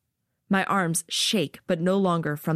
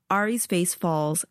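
ordinary delivery, nothing marked

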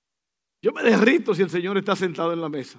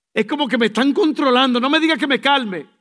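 mock irritation; 6 semitones higher, 7 dB louder than usual. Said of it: "irritated reaction quoted".

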